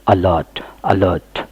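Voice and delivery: "अलर्ट" starting with short uh; initial uh sound. Shown as a demonstration. Teacher showing A as uh in a word, uh pronunciation. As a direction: model "अलर्ट" twice, uh-lert with falling end. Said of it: "अ भी हो सकता है".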